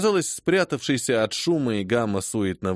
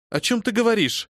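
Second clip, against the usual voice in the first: furious exclamation; 10 semitones higher than usual. Acted. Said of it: "яростно воскликнула госпожа".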